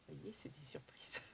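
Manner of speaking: soft secretive whisper. Real, not acted. Vlogger hiding gift surprises from viewers, whispering.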